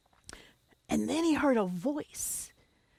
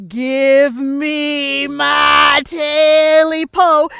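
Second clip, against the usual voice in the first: very slow, drawn-out louder moan; ghostly creature voice; 2 semitones higher, 15 dB louder, much slower than usual. Acted. Creature demanding its tail back from the man, slow eerie moan. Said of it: "he heard that voice again".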